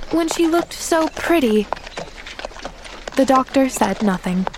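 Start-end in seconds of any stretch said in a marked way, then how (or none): 0.1-1.7 high, wistful protest; insistent. A narrator's reading. Girl defends telling her aunt, mildly insistent. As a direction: keep pressing the point gently. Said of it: "maintained Pollyanna".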